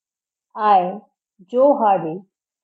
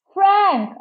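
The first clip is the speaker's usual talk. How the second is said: shouted name; 8 semitones higher than usual. A narrator's reading. Joe calls his brother in the ocean, high shout. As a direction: call out for a lost brother. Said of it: "I shouted".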